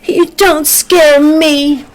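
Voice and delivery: high and slow small-tree voice; little Rocky. A narrator's reading. Little Rocky answers the bullies, high and slow.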